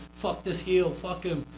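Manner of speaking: acted crowd abuse; mock yelling fan. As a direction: jeer like a riled-up crowd member, without real volume. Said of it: "I'm gonna start yelling at him".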